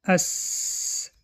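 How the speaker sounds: long unvoiced hiss of س; teacher hisses the letter as a model. Shown as a demonstration. Teacher shows students the whistling hiss of سین. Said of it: "هنگام تلفظ حرف سین".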